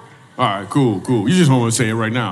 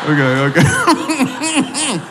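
laughing okay; 11 semitones higher, much slower than usual. Real, not acted. Laughing realization of joke.

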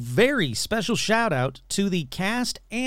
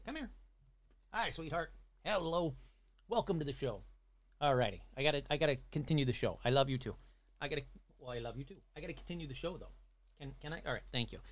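murmured, affectionate aside, much softer; soft pet talk; 4 semitones lower, 12 dB quieter, faster than usual. Real, not acted. Host pauses the show to greet Rum in his lap, hushed and fond. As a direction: talk quietly and gently to the pet on your lap.

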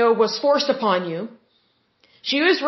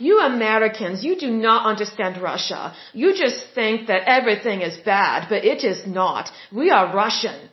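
put-on lower-pitched, ominous Russian accent; thick Russian accent; 3 semitones lower than usual. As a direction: imitate a stern Russian woman with a thickened accent. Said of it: "her accent got really thick".

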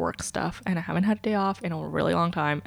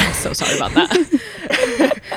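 high, loud, laughing apology; 10 semitones higher, 5 dB louder, slower than usual. Real, not acted. Apologizing to co-host for the silly accent, laughing. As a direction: crack up laughing as you apologize.

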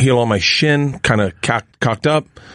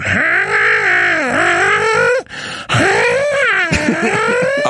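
high crying yowl; cat yowl. Retold or imitated. Cat yowling outside, high crying wail. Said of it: "I hear my cat going".

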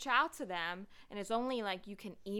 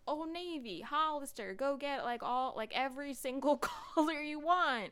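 higher-pitched, gushing shopping hype; hyped-up retelling; 6 semitones higher than usual. Retold or imitated. Recalling high school shopping crazes, hyped-up imitation. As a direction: imitate an excited rush to buy.

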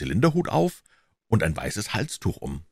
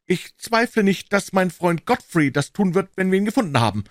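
put-on high, eager young man's voice; Offerton chiming in; 14 semitones higher, 4 dB louder than usual. A narrator's reading. Offerton interrupting Holmes with a suggestion, high and eager.

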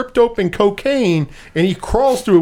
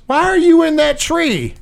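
mother's voice; 9 semitones higher than usual. Retold or imitated. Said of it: "And she goes".

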